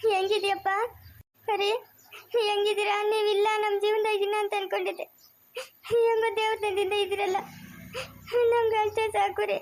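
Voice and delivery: crying, high-pitched voice; tearful relief. Acted. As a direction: speak through tears of relief. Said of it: "ಅತ್ತೆ, ಅಳ್ಬೇಡ ಅಂತ ಹೇಳ್ತಾ ಇರೋದು". (ಅಳುತ್ತಾ, ನಿಟ್ಟುಸಿರಿನ ಸಮಾಧಾನದಿಂದ)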